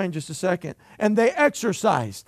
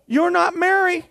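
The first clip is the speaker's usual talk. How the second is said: higher, incredulous retort; mock exasperation; 9 semitones higher, 4 dB louder than usual. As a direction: throw it back at grandma. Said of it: "I'm like".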